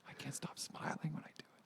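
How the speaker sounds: whispered through a laugh; whispering. Pretend. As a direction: giggle while whispering. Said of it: "just whisper".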